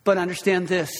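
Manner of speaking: usual speaking voice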